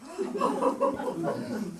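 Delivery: high laugh after the threat; real laugh. Real, not acted. Woman laughing at her own cab threat. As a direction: burst out laughing at yourself.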